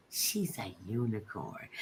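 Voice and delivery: slow, very soft and low; awed hush. Retold or imitated. Little twin marvelling at a celebrity, hushed. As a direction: say it slowly, hushed with wonder. Repeats twin's awed line.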